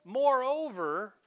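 "Moreover" high, loud and drawn out; emphatic. Acted. Preacher quoting Peter doubling down, "Moreover" loud and emphatic. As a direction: hit "Moreover" with over-the-top force. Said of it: "He says emphatically".

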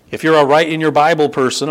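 ordinary talk, nothing marked

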